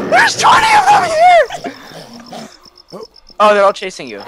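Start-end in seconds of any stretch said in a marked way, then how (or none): 0.1-1.5 high panicked yelling; panicked shout. Real, not acted. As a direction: laugh then yell in panic. Swarmed by zombies.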